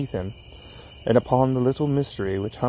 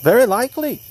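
high, loud, scornful retort; angry cry; 11 semitones higher, 6 dB louder, faster than usual. A narrator's reading. Young Lee retorting to Bellingham in a quarrel, angry cry. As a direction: fling it straight back at him. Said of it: "cried the other".

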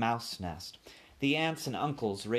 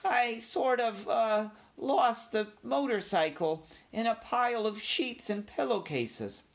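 small ashamed voice up high; embarrassed confession; 12 semitones higher than usual. A narrator's reading. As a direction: confess sheepishly, dragging it out. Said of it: "embarrassed and ashamed".

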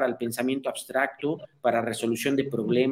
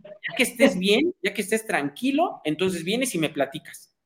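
cold practical brush-off; 6 semitones higher, 5 dB louder than usual. Retold or imitated. Rational tells a crying friend to calm down first, high and detached. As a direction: hand them a tissue and tell them to settle down. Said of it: "te dice".

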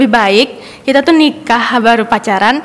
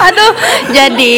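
laughing; 7 semitones higher than usual. Real, not acted. Embarrassed laugh about being single.

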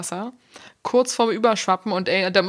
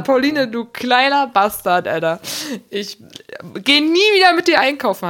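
gespielte Wut; 4 semitones higher, 4 dB louder than usual. Retold or imitated. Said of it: "hab ich gesagt".

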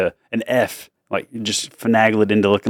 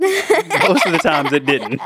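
laughing; 3 semitones higher, slower than usual. Real, not acted. Funny yo-yo story.

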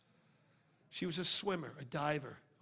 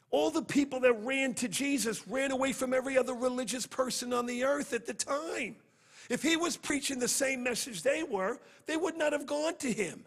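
preaching at full force; 8 semitones higher, 6 dB louder than usual. Real, not acted. Preacher raises voice.

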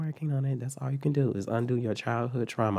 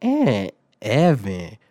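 very slow, stretched-out disbelieving repeat; incredulous echo; 2 semitones lower, 4 dB louder, much slower than usual. Retold or imitated. Student echoing own name after being accused, incredulous echo. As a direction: draw out each word as if you misheard.

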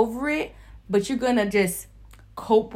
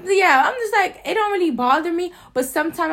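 animated; 9 semitones higher, 8 dB louder than usual. Real, not acted. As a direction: keep the raised heat. Animated continuation of venting.

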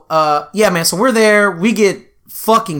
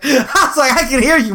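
raised pitch, breaking into laughter; laughing; 8 semitones higher than usual. Real, not acted. Retelling his comeback to the back seat, laughing. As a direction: laugh through the retold comeback. Laughing at own retold outburst.

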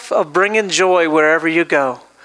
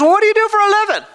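put-on high, fast chirpy older woman; lightly voiced eager old lady; chatty lady voice; 10 semitones higher, much faster than usual. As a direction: rattle it off like a nosy neighbour.

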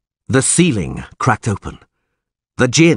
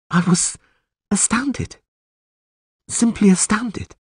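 astounded; 12 semitones higher than usual. A narrator's reading. Yennefer amazed by his wish.

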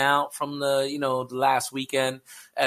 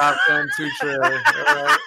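laughing shout; 13 semitones higher, 7 dB louder than usual. Real, not acted.

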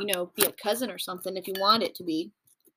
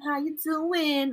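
slow, raised flirty greeting; 5 semitones higher, much slower than usual. Retold or imitated. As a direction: stretch out the come-on. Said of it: "where you're like".

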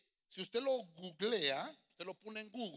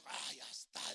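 whispered relief; 6 semitones lower, 5 dB quieter, slower than usual. Retold or imitated. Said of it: "dije yo".

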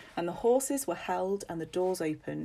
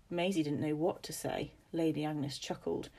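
ordinary delivery

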